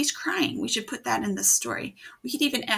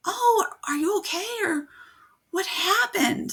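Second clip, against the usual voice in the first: light caring mom, higher; a hint of a worried mother; mom's voice; 11 semitones higher, 4 dB louder than usual. Teacher voices a mom asking her son what happened, caring. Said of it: "She'd probably say something like".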